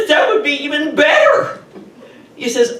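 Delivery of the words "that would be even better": higher and louder, laughing through it; laughing delight. Retold or imitated. Woman answers the policeman who found her thieves, laughing. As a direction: giggle with disbelieving joy. Told purse was recovered.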